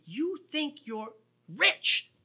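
high, slow, drawn-out mockery; mocking challenge. Preacher quotes Jesus to the churches, mocking. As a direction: stretch it out high and incredulous. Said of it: "where he says to them".

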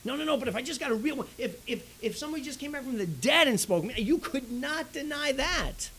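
high, fast, pushy mimicked plea; mock rich man pleading from Hades; insistent rich man. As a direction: talk over him, sure you're right.

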